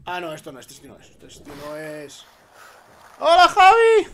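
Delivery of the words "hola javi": shouted cheerful hello; loud high greeting. Real, not acted. Loud sudden greeting in chat.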